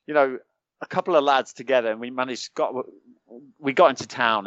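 usual speaking voice

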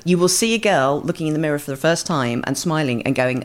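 ordinary talk, nothing marked

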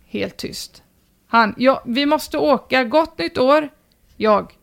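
normal talking voice

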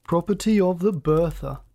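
mocking echo with swooping emphasis; drawled. A narrator's reading. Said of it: "he drawled".

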